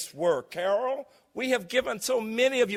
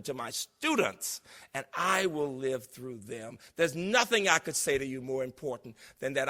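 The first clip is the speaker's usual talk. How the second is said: normal talking voice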